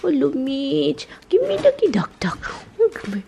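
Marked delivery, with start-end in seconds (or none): 1.3-3.2 whispered coaxing to the dog; coaxing whisper to the pet. Real, not acted. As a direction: breathe the words to the puppy, high.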